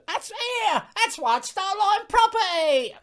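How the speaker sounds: very high, loud mock outrage; drawn-out officious shout.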